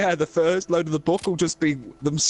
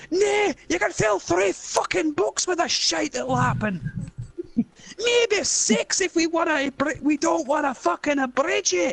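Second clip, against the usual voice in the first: laughing Scottish rant; 12 semitones higher than usual. Acted. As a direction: pile on the insult while laughing. Same Scottish character.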